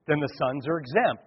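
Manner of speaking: normal talking voice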